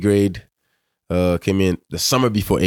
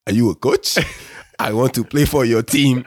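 higher, joking imitation of an African accent; teasing African accent; 6 semitones higher than usual. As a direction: tease him by putting on the African accent. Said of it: "as a joke, he'd be like".

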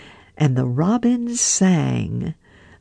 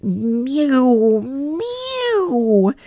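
cat mewing; 9 semitones higher than usual. A narrator's reading. Simpkin interrupting the mice, high mew. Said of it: "interrupted Simpkin".

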